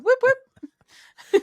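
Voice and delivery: high, loud whooping; playful high-pitched hooting; mock cheer. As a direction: whoop like a mock siren of pride. Mock cheer for family background.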